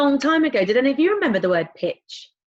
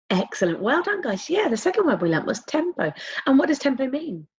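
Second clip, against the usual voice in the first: usual speaking voice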